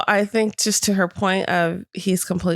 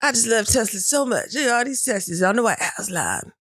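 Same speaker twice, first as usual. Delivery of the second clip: lightly voiced Trump, gushing and higher; Trump impression; 5 semitones higher than usual. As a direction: gush like Trump showing off the cars. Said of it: "it was very Trump".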